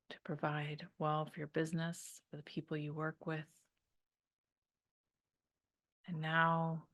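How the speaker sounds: calm meditation voice with long pauses; guided meditation. Real, not acted. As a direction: guide them to unwind, leaving room to breathe.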